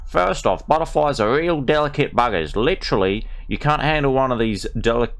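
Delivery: broad ocker Australian voice, played for laughs; reading a butterfly book as a brash Australian; mock Australian accent. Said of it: "we're going with the Darrow Australian".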